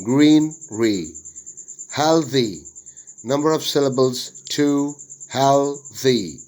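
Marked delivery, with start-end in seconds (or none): 0.1-1.2 "greenery" split as green, ree; syllable by syllable. Shown as a demonstration. Teacher showing syllable division, "greenery" broken in two. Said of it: "Syllable division". 5.3-6.4 "healthy" split as hel, thee; syllable by syllable. Shown as a demonstration. Teacher dividing worksheet words, "healthy" broken into syllables. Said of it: "syllable division of the words".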